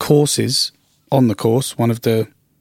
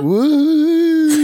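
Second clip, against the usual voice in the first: excited cheer. Real, not acted. Guest cheers back on intro.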